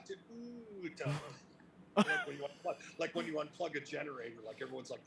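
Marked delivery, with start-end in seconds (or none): 0.1-0.9 high soft falling ooh; crowd groan. Retold or imitated. Crowd wincing at the blood, soft high ooh. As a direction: let the crowd sigh out.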